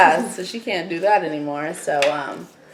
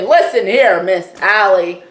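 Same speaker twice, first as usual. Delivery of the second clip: loud, higher bossy demand to Allie; mock stand-up speech; 6 semitones higher, 6 dB louder than usual. Best friend telling off Allie, loud and high. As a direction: stand up to her, raise the voice. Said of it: "you stand up to her".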